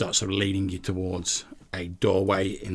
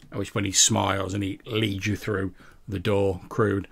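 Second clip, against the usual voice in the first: usual speaking voice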